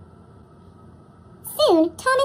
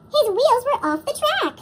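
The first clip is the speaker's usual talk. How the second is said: ordinary delivery, nothing marked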